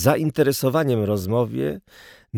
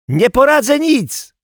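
very high, loud yelled protest; boy's high holler; helpless shout; 6 dB louder than usual. Acted. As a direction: cry out that it's hopeless.

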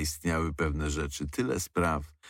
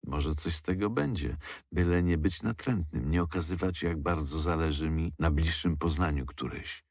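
ordinary delivery, nothing marked

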